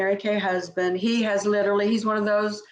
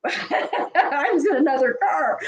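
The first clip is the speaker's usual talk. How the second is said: higher, drawn-out words through a laugh; amused laughing aside; 6 semitones higher than usual. Real, not acted. Guest telling how she missed the ride, laughing. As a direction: laugh while you admit it. Laughs at her own letter story.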